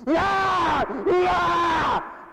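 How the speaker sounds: high shouted ah; mock battle yell. Boy waving stick to impress, mock war cry. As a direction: yell like a kid showing off.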